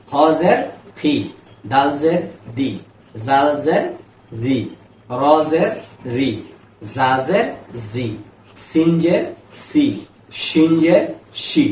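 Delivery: teacher drilling each Arabic letter with kasra; each letter name, then "জের", then the "ই" syllable, said cleanly for repeating; reciting letter drills. Shown as a demonstration. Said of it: "আমি একবার বলে দিই".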